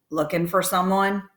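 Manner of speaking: lightly voiced clerk with cigar in mouth; cigar-chewing hotel clerk. A narrator's reading. Hotel clerk eyes a visitor, lightly voiced cigar-chewer. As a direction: talk around a cigar, a hint of character.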